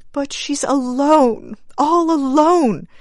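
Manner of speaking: high, slow, aching grief; grief-stricken. Acted. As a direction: hold back tears on alone. Grieving mother answers husband.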